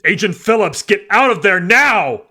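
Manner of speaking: shouting. A narrator's reading. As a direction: yell her out of danger. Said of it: "Agent Durwood shouted".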